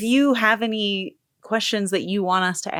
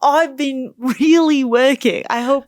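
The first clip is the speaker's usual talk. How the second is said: slow, higher-pitched attempt at Australian; Australian accent; 4 semitones higher, 5 dB louder, slower than usual. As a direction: draw it out in an imitation Australian accent. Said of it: "don't actually think your accent's that bad".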